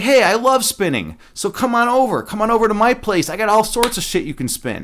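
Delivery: lightly voiced gushing queen; cheery queen. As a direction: sell it like an excited host. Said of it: "And the queen's like".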